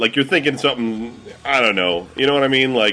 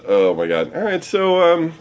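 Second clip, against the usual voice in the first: ordinary talk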